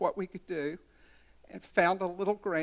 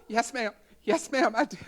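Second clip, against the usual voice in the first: higher, giggling guilty reply; laughing yes ma'am; 5 semitones higher, 4 dB louder than usual. Retold or imitated. Staff member answering Lucille's question, sheepish laughing. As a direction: laugh through a caught-out answer.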